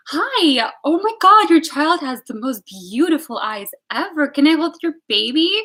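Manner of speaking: put-on high, loud gush over a baby; gushing excitement. Friend fawning over someone's child, gushing excitedly. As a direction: imitate an overexcited baby lover.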